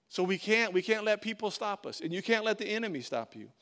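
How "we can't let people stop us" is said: ordinary talk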